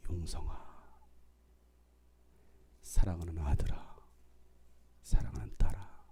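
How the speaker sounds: low, hushed, loving address; tender divine call. Acted. Jesus calling his children today, low hushed tenderness. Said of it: "예수님 오늘 부르시는 거예요".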